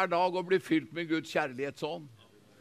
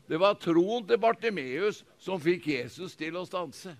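normal talking voice

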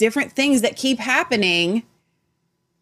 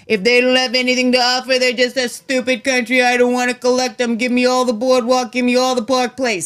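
Trump impression; 3 semitones higher than usual. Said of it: "That's Trump with countries".

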